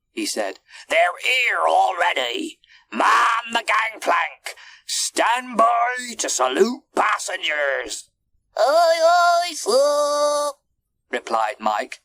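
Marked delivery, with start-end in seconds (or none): 0.9-8.1 high, raised, drawn-out commands; ship's captain hollering; captain's urgent orders. A narrator's reading. Captain urgently ordering his mate. 8.6-10.5 very high, loud monkey voice; high-pitched shouted answer; sailor's brisk acknowledgement. A narrator's reading. Monkey mate answers captain's orders.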